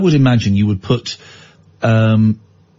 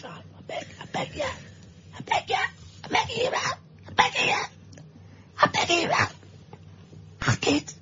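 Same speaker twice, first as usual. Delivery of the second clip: begging in tears; 12 dB quieter than usual. Acted. Child begging his mam to let sister down, mock sobbing. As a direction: beg your mam through mock tears.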